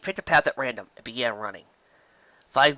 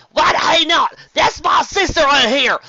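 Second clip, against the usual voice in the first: furious outburst; 11 dB louder, slower than usual. Acted. Applejack furious over missing sister.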